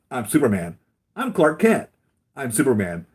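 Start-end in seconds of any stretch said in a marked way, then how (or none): 1.2-1.9 high, loud goofy Clark voice; mock Clark Kent. Villain demonstrating glasses on, high goofy Clark. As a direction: pitch it up like a meek nerd. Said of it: "Takes them off".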